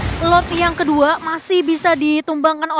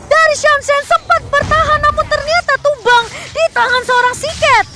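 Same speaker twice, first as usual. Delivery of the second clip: fast excited play-by-play, higher pitch; esports caster; 8 semitones higher, 5 dB louder than usual. Real, not acted. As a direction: call the teamfight as it happens.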